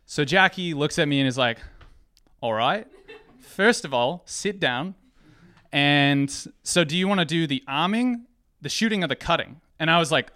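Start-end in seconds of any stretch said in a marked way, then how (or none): 2.4-9.6 higher-pitched New Zealand coworker voice; New Zealand accent, pitched up for a coworker. Acted. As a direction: put on an amateur Kiwi accent. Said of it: "I'm gonna do a New Zealand, Australia accent".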